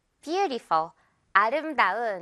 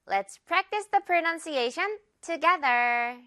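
slow; 6 semitones higher, much slower than usual. Shown as a demonstration. Slow English instruction.

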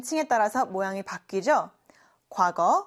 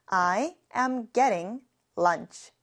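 slow repeat-after-me; much slower than usual. Shown as a demonstration. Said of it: "같이 해볼까요?".

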